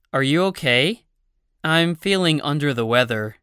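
ordinary delivery

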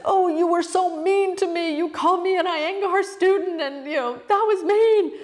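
very high, whiny tearful protest; mock crying. Crying student confronting her guru at the stairs, exaggerated high whine. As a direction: wail the complaint like a hurt child. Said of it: "why crying?".

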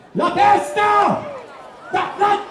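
"La testa!" urlato lento e acuto; grido acuto. Real, not acted. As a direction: urla per spingere il bambino. Incita il bambino ad alta voce. (urlo acuto e trascinato al bambino sul palco)

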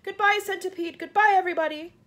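high, loud last goodbyes; gasped farewell. A narrator's reading. James to his friends as the peach falls, desperate farewell. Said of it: "gasped James".

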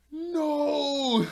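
long, high mock wail; big high yell, held; dismayed cry. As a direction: cry out in drawn-out, hammed-up despair. Character's dismay as bee drops.

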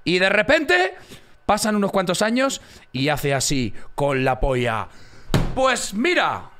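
loud, high burst; sudden excited punchline. Real, not acted. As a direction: build up the big reveal. Excited storytelling.

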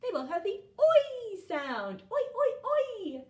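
oi called out high and loud, stretched slow; excited sound demo. Shown as a demonstration. Teacher showing children the oi sound, loud and high. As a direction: sing out oi, oi, oi with big energy. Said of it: "What do you notice about foil and noise?".

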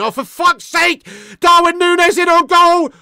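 high, loud exasperated yell; alarmed shout. Real, not acted. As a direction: burst out in alarm as the striker breaks through. Alarm at opponent's scoring chance.

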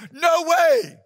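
high, loud outraged no; loud refusal. Acted. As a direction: shout it down flat. Younger brother refusing.

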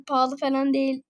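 ordinary talk

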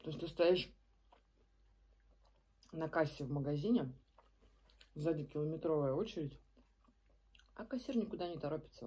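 talking between bites; speech muffled by food; mouth full, chewing. Real, not acted. Seen 0: Bites wrap at 1.4, chews with full cheeks, keeps talking.

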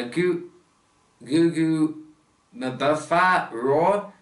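sounding out Gugu Mbatha-Raw slowly, haltingly; "Gugu Mbatha-Raw" tried carefully, very slow and broken up; unsure pronunciation. Real, not acted. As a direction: feel your way through "Gugu Mbatha-Raw", drawn out piece by piece. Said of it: "I apologize if I pronounce this".

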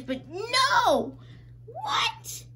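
very high squeaky disbelief; stunned disbelief. Real, not acted. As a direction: squeal as if you can't believe it. Disbelief at the pulled card.

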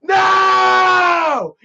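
drawn-out high, loud scream of "no"; imitated scream. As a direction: scream it just like the stunned dad did. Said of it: "then he screamed it, dude, just like that".

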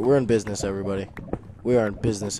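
ordinary talk, nothing marked

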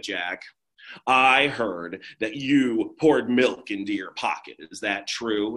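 put-on low, deadpan bored girl; flat grumpy girl. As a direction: grumble it like a girl who never laughs. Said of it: "She's like".

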